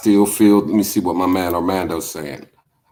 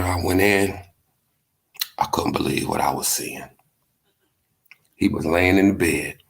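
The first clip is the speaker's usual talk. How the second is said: usual speaking voice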